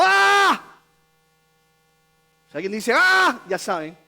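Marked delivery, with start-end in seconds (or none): 0.0-0.6 drawn-out high mock scream; sustained acted shriek; imitated demon cry. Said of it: "Dio voces diciendo". 2.9-3.4 high mock shriek; short, high demonstration cry; imitated possessed scream. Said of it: "está endemoniado".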